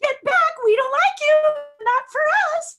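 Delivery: loud, very high cartoon mouse falsetto; squeaky, gleefully mean rejection; Mickey Mouse. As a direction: imitate a cartoon mouse shooing someone away. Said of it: "picture Mickey Mouse saying that".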